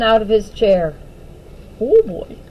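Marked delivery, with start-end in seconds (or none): none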